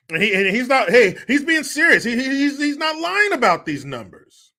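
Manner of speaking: high, loud, stammering parody; mock earnest insistence. Host mock-defending the governor, high stammering voice. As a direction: insist in a high, worked-up put-on voice.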